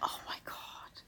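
faint unvoiced whisper. Real, not acted. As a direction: breathe it as a whisper. Whispered aside.

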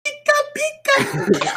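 very high, slow squeaky Pika cry; Pikachu impression. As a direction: squeak it like a tiny Pokemon. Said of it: "you will do a Pokemon impression".

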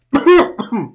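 loud, high-pitched coughing fit; acted burning-shot cough. Newcomer swigs his first strong shot, coughing from the burn. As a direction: cough as if the drink burns. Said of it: "you can tell it kind of burns him".

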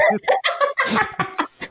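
high trailing laugh. Real, not acted. Laughs after remark. (risada aguda)

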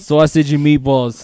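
loud, slowed Italian-American accent. Shown as a demonstration. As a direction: boom it out like an Italian deli owner. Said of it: "I can't do an Italian accent".

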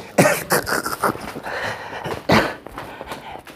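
long wheezy laugh. Real, not acted. Guest after insulting the host, long laugh. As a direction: laugh on and on, mostly breath.

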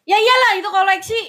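high, loud insistence; emphatic protest. Real, not acted. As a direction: shoot down the teasing, loud and high. Protests teasing about grandma's dolls. (membantah dengan nada tinggi)

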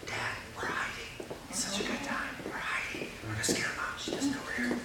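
put-on whispered, child's secret; imitating daughter's whisper. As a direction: whisper it like a child hiding in a tent. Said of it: "my daughter will whisper to me".

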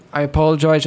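ordinary talk

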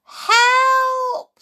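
high, long, loud shriek; scream for help. A narrator's reading. Boy sliding out of control, screaming. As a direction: scream out for rescue. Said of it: "screamed Tom".

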